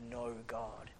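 low, soft, weighty statement; deliberate denial. Acted. Preacher quoting the fool's heart, deliberate denial. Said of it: "they're deliberately saying".

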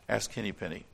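ordinary delivery, nothing marked

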